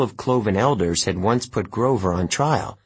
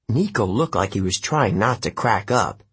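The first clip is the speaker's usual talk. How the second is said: ordinary delivery, nothing marked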